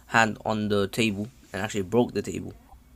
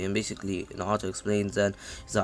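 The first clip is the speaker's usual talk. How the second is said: normal talking voice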